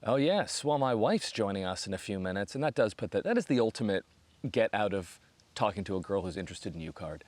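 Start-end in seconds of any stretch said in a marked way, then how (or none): none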